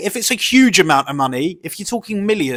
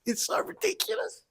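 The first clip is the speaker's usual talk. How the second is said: "It's so ridiculous" in a much higher, squeaky laughing voice; squealing laugh; 12 dB quieter than usual. Real, not acted. Cracking up at the online fight drama, high squealing laugh.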